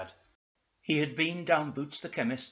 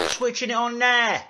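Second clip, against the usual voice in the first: loud, high announcement; eager, high-pitched shout; Dougal; 10 semitones higher, 10 dB louder than usual. Acted. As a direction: call out in a raised character voice. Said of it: "said Dougal".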